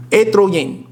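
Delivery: Estrogen as "eh-tro-yen" in a mock Spanish accent; Spanish-style, drop the s and soften the g in "Estrogen". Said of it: "Why do I have a Spanish accent".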